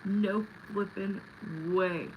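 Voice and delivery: ordinary delivery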